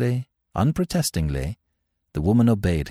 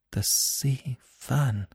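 very slow, higher and soft; slow repetition; 6 semitones higher, 6 dB quieter, much slower than usual. A narrator's reading. Captive woman echoing Smith's question, slow repetition. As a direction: repeat it slowly, word by word. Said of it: "she repeated slowly".

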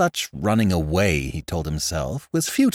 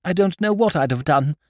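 much higher, eager silly-ass aristocrat voice; flustered young lord; 9 semitones higher than usual. Acted. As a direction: babble it out like a nervous young toff. Lord Drever thanks Jimmy.

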